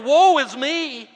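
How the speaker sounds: loud, drawn-out, high cry of woe; Isaiah's lament. Acted. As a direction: cry out slowly like the prophet. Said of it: "like Isaiah saying".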